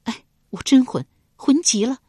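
懊恼地自责; self-reproach. Acted. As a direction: slap your forehead in words, annoyed at yourself. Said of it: "暗自责道".

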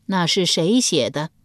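ordinary delivery, nothing marked

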